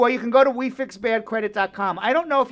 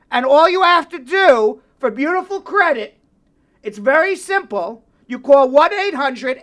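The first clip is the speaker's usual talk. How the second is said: ad read; 4 semitones higher, 6 dB louder than usual. Acted. Live ad read.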